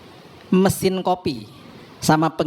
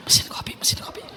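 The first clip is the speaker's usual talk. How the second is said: hushed secret whisper; 4 dB quieter than usual. Retold or imitated. Husband whispering to wife about the coffee machine, hushed whisper. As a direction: whisper it so the sister won't hear.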